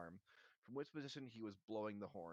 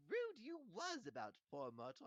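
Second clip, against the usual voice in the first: very high impish taunt; Peeves, retake; 12 semitones higher than usual. A narrator's reading. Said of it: "I like that better".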